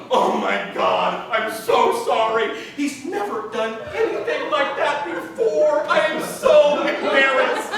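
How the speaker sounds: slow, drawn-out wailing apology; moaning apology. Retold or imitated. Owner moaning apologies to her bitten date. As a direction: moan it out, sing-song.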